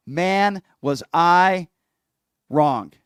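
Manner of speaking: real anger, pushing each word out; fired-up radio host admitting he was wrong; angry. Real, not acted. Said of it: "I have some anger in me today".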